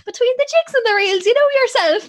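very high, loud mocking repeat; hammed-up echo of Mum's saying; incredulous echo. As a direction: throw the phrase back in disbelief. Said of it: "what the fuck was that".

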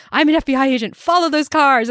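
loud, urgent agent's command; mock authority; commanding order. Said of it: "he's like".

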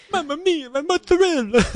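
hammed-up Italian chef voice; Italian accent. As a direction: overdo the Italian like a cartoon chef. Mock Italian over mozzarella.

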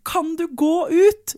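a mother losing her patience, drawn-out yelled order. Retold or imitated. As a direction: reenact snapping at the kid to get out. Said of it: "jeg hevet stemmen ordentlig".

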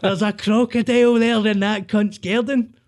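a hint of her hysterical alarm; frightened girlfriend.

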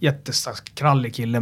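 usual speaking voice

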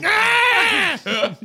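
high mock scream of pain; a sudden high yelp as the legs are crushed; witch scream. Player voicing the dying witch as her legs are crushed, high scream. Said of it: "she crushes her legs, like".

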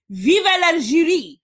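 put-on slow, lightly French-accented cheer; mock French accent. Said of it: "I was just practicing my French accent".

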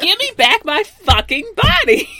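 high, loud hammed-up shout; mock furious demand. Co-host parodying the villain's catchphrase, mock furious.

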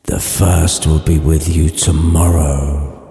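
slow, low, hushed ghostly moan; spooky ghost voice. Acted. Marley's ghost answering Scrooge, slow spooky low voice. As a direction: drag every word out like a chained ghost.

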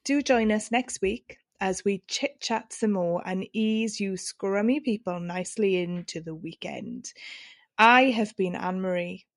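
upbeat show sign-off voice; podcast outro. Acted. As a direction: wrap up the episode like a host. Podcast sign-off over music.

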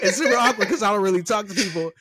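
high, loud, laughing parody of awkward talk; mock awkward voice. Mocking the coworker's awkwardness, high and laughing. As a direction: squeak it out as a mocking imitation. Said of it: "they're like".

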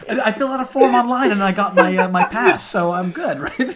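high, loud, laughing boast; laughing mock bragging. Amateur hitman bragging to his client, high and laughing. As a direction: giggle through the amateur's pride.

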